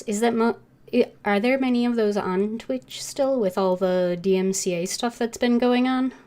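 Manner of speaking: ordinary delivery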